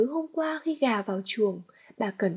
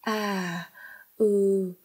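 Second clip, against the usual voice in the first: flustered filler; 3 semitones lower than usual. A narrator's reading. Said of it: "khổ sở chống chế bằng những từ ngữ vô nghĩa".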